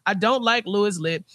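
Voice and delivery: ordinary delivery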